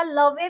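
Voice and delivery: लवे as "luh-vay", final e sounded; mispronounced love. Shown as a demonstration. Teacher showing what not to say, लवे as "luh-vay". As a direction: add an "ay" syllable in लवे. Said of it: "तो नहीं बोलेंगे हम".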